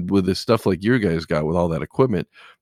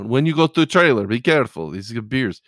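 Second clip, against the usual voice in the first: put-on higher, louder Russian-accented warning; imitated Russian driver, pitched up; 5 semitones higher, 3 dB louder than usual. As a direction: warn them in a mock Russian accent. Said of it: "he had that Russian accent".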